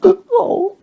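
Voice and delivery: put-on high wailing old man; hammed-up sob; mock crying. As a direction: imitate an old man bursting into tears. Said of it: "then he started crying".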